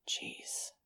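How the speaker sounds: breathed out under the breath, no voice; just air, stunned; awed exhale of a word. Acted. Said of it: "Fang breathed".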